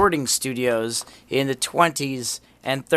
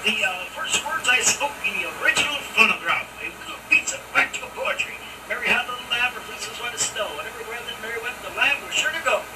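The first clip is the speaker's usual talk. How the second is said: high Edison voice through a hissy carbon mic; gritty early microphone; 9 semitones higher, faster than usual. Real, not acted. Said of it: "except for the carbon hiss".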